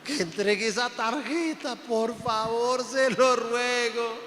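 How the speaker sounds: God crying. Retold or imitated. Preacher imagining God weeping over indebted believers, hammed-up tearful lament. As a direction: play God weary and weeping over his children. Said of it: "me imagino a Dios llorando".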